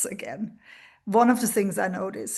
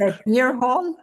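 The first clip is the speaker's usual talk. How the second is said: puzzled echo, higher and louder; surprised; 4 semitones higher, 6 dB louder than usual. Real, not acted. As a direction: ask back in disbelief. Puzzled echo of odd phrase.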